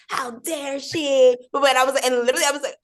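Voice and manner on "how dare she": high, slow, drawn-out indignation; hammed-up fan outrage; high and slow, mock scandalized; mock outraged fan. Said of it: "people being like".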